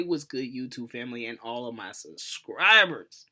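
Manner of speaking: ordinary delivery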